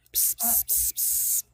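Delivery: soft psst psst to a cat; psst calling. Real, not acted. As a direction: hiss a quiet psst psst.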